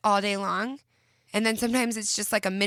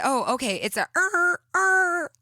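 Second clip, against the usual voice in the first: high, loud strained er er noise; imitating son's sound; 9 semitones higher, 6 dB louder than usual. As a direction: mimic a child's odd made-up noise. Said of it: "what if you gave it a sound".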